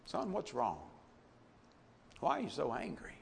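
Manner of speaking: a father easing his angry son; tender father. Acted. Preacher voicing the father comforting his older son, soft and tender.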